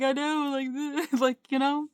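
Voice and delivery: mildly acted upset answer back; upset retort. Answering the voices in the head, upset and exasperated. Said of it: "I get more upset".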